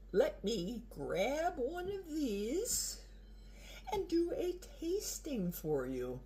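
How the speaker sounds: slow hushed whisper. Real, not acted. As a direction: breathe it softly and slowly. Hushed aside before tasting food.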